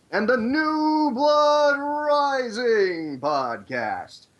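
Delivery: drawn-out booming announcer call; fighting game announcer. Acted. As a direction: stretch it out like a ring intro. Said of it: "I love your announcer voice".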